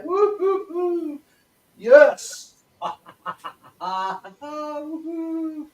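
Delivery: high whoops and laughter; excited celebration. Shown as a demonstration. Coach switches to the celebration exercise, elated. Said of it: "getting excited and anticipating something exciting".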